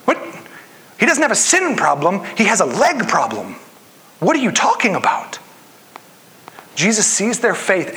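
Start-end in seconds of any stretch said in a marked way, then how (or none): 0.0-5.4 a hint of baffled protest, pitched up; lightly voiced puzzled friends objecting; confused friends on the roof. Preacher voicing the men on the roof, mildly baffled protest. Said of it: "You can almost hear the men up on the roof going".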